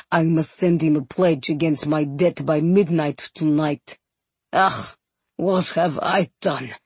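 low, remorseful captain's voice; deep, softening lament; deep bass groaning. A narrator's reading. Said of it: "Groaning, he smacked the tops".

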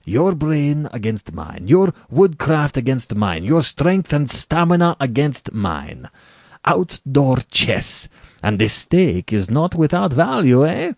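slow, high, emphatic relish; enthusiastic. A narrator's reading. General pitching the contest to his opponent, enthusiastic. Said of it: "the general said enthusiastically".

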